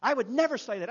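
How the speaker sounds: loud, high-pitched indignant protest; angry woman's retort. Retold or imitated. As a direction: act out her angry denial, loud and high. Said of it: "she got mad. I mean, she got angry".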